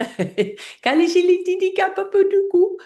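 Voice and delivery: high laugh; high giggling; giggly gibberish laugh. Real, not acted. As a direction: laugh through nonsense syllables.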